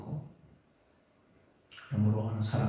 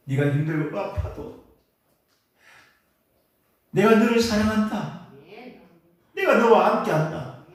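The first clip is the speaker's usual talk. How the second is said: higher and louder, building; rising loving declaration; 8 semitones higher, 5 dB louder than usual. Shown as a demonstration. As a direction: proclaim the love with growing force. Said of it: "내가 나를 위로해야 되겠다". (점점 고조되며 크고 높게 사랑을 선포하듯)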